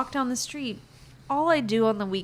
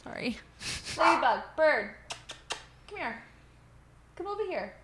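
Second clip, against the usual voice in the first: sweet-talking a pet bird, pitched up; calling the bird; 8 semitones higher than usual. Real, not acted. Speaking to her pet bird across the room, high coaxing call.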